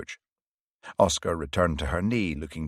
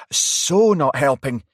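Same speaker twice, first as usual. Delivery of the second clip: louder, slower, sharp retort; fired back; 8 semitones higher, 9 dB louder, slower than usual. A narrator's reading. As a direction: snap it back at him. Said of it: "fired back Varry".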